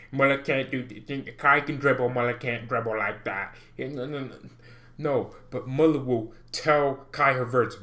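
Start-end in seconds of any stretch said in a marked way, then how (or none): none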